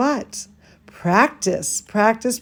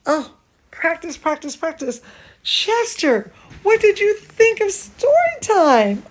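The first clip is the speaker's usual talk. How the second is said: puppet voice; 7 semitones higher than usual. Acted. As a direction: lightly voice the little dog puppet asking. Voicing Chester the doll.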